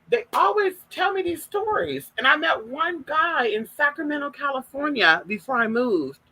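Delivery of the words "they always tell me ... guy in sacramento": normal talking voice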